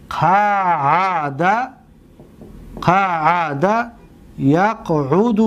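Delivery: قعد and یقعد drawn out very slowly; slow demo. Shown as a demonstration. Teacher drilling قعد یقعد for students, slowly. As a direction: stretch قعد یقعد sound by sound. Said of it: "ببینید،".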